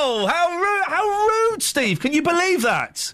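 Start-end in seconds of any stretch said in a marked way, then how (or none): 0.0-0.9 mock outrage. Mock outrage at island rejection. 0.9-1.6 mock outrage.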